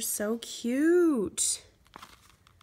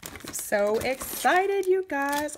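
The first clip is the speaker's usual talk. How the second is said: louder, slow, sing-song delight; excited gush; 5 semitones higher, 5 dB louder, slower than usual. Real, not acted. Vlogger telling viewers about her favorite stickers, slow loud gush.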